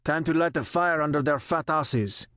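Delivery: put-on French-accented sneer about lighting a fire; thick French accent. A narrator's reading. Christophe to the crew in the helicopter, French accent. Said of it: "spoke with a thick French accent".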